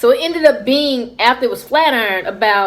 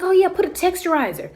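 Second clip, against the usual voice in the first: higher, lightly put-on store clerk; clerk's voice; 8 semitones higher than usual. Storyteller voicing the beauty store clerk, high and eager.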